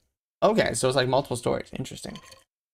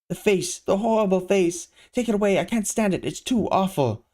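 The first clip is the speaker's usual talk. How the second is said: horrified wail; 6 semitones higher than usual. Acted. Villain horrified by his face.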